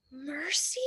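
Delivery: soft, rising wondering whisper; surprised whisper. Acted. Baby realising the monster is Mercy, whispered. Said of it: "said Baby".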